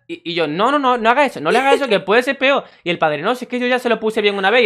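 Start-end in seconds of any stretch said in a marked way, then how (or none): 0.5-2.6 high, louder urgent pleading; urgent warning. Retold or imitated. Lifeguard warning the father, urgent and raised. 3.2-4.6 higher, louder offhand father; dismissive father. Retold or imitated. Father waving off the lifeguard, mock offhand.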